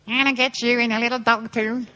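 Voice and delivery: lightly voiced movie witch; witch impression. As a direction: menace like the witch of Oz. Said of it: "that lovely line from Wizard of Oz".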